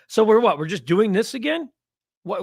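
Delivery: rising, incredulous; can't believe they're at it again; exasperated disbelief. Real, not acted. Host reacting to a fan comment, exasperated disbelief.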